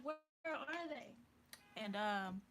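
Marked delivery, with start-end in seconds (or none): 0.0-1.1 much higher and louder, laughing in disbelief; where are they, incredulous. Real, not acted. Looking for them, laughing in disbelief.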